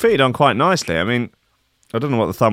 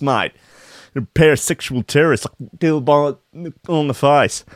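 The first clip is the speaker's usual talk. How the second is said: put-on broad Australian accent; 6 semitones higher, 3 dB louder than usual. Hospital CEO apologizing about the doctors, in a loud, high, broad Australian accent. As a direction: play the hospital boss as a broad Aussie bloke. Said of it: "Oh no, he's Australian, isn't he?".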